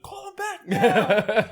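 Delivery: loud, high, drawn-out yell; urgent shout, stretched out; retold yell. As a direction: re-enact an excited holler across a restaurant. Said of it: "We were, like, yelling in the restaurant".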